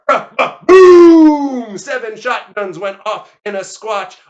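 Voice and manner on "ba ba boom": booming mouth-made shotgun blast; stutter, then a huge long boom; imitated gun volley. Narrator sounding seven shotguns, loud imitated blast.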